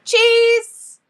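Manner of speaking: very high, loud, held-out cry; loud, high photo-pose shout; photo cheese call. Real, not acted. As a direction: belt it out high for the camera. Posing for a group picture.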